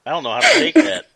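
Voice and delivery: laughing out the words, high and fast. Real, not acted. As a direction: giggle through the line. Laughing at guest's teasing remark.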